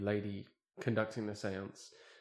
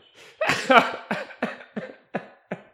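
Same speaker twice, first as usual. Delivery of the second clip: can't hold it in, cackling; high shrieking laugh; 13 semitones higher, 9 dB louder than usual. Real, not acted. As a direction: burst into high wheezing laughter. Teller cracks up.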